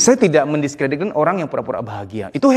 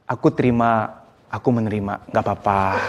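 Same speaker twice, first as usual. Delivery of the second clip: calm acceptance; 8 semitones lower, 3 dB quieter than usual. Shown as a demonstration. Telling yourself it's okay when feeling down, calmly accepting. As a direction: let it go gently, voice dropped low. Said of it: "coba kamu ngomongnya begini".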